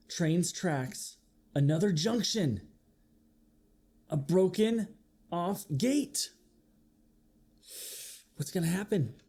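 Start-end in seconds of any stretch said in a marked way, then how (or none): none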